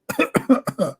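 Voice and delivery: weak staged cough; fake cough. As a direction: cough on purpose. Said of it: "Should I cough more".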